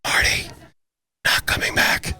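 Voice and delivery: breathy stage whisper; sports whisperer. Acted. Answering Marty as the Sports Whisperer, whispered. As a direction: whisper it as a hushed bit. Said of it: "asked for the Sports Whisperer to come back".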